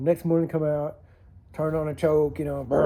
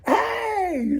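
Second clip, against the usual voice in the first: bright shouted hello; calling out hey. Retold or imitated. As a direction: holler a cheery greeting at a neighbor. Calling out to neighbor while driving.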